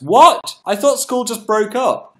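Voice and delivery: loud, high disbelief; shocked. Real, not acted. Reacting to a viewer's school date, loud and high. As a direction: react like it's news.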